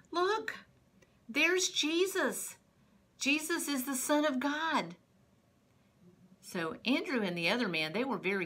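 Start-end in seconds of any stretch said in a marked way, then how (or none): 0.1-5.0 high, drawn-out amazed cry; excited announcement. Acted. John pointing out Jesus to his listeners, excited announcement. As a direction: proclaim it with wonder, higher and slower.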